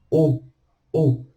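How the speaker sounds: "o" as one closed oh, the French eau; single O sound. Shown as a demonstration. Teacher merging E-A-U into one sound, pronunciation demo. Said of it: "aglutina tudo em um som só".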